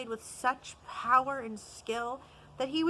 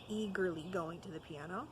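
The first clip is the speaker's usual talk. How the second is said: plain reading voice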